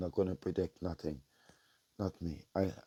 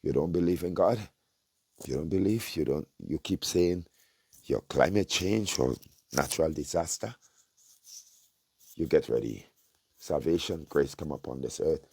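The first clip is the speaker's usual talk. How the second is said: louder, rising challenge; fiery preaching; 3 semitones lower, 3 dB louder than usual. Real, not acted. Prophet challenging unbelievers, loud fiery preaching. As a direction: challenge the unbeliever, then bless.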